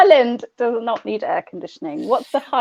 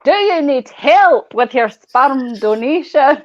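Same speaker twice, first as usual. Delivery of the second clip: very slow, put-on Scottish prank call; Scottish accent; 2 semitones higher, 3 dB louder, slower than usual. Prank caller offering boys help, in a hammed-up Scottish accent. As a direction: stretch it out like a dodgy Scottish caller. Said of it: "we'd be like".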